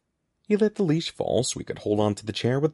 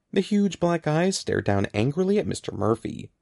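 plain reading voice

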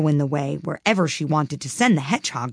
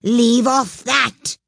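high, loud, drawn-out royal scream; screamed order; 7 semitones higher, 5 dB louder, much slower than usual. A narrator's reading. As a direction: scream at them to stop. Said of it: "screamed the Queen".

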